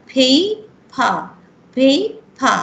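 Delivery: পেইপা with a breathy p and an open "a" at the end, no r; paper without r. Shown as a demonstration. Teacher showing students how paper ends, no r. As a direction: stretch out পেইপা slowly, no r at the end. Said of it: "pronunciation is a".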